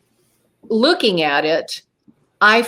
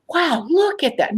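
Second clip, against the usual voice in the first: high, swooping retold awe; mesmerized wonder; 8 semitones higher than usual. Witness recalling the beautiful craft, mesmerized wonder. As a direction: marvel at it, pitched well up. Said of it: "I was mesmerized".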